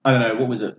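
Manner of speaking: ordinary delivery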